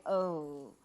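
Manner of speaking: understated gloomy reading; mock grim. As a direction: drop the voice like reading a dark warning. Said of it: "and it's like".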